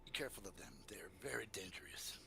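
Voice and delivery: put-on low, soft accented caution; hushed warning. Drow warns the party about crawlers, low and hushed. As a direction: warn them softly, almost under your breath.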